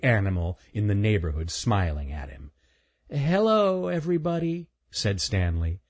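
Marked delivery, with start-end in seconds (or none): 3.1-4.7 put-on higher boy's voice, hello said smoothly with no stammer; lightly voiced young boy. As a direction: greet everyone clearly. Stanley greets the animals.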